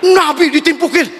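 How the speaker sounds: usual speaking voice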